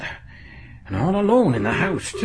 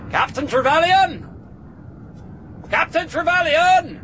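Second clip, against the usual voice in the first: calling out. Acted. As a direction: shout the name up at dark windows. Calling out to house owner.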